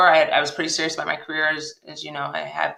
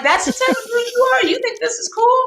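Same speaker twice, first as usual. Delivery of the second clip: high, incredulous challenge. Retold or imitated. Woman challenging her boyfriend, high and incredulous. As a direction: scoff at him, amazed. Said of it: "And, like".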